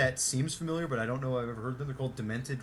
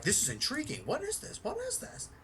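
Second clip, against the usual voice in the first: rising into a much higher, squeaky puzzled voice; mock intrigued listener; 13 semitones higher, 4 dB quieter than usual. Mocking new subscribers who found the tape, high puzzled voice. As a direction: play the baffled newcomer, climbing higher on each question.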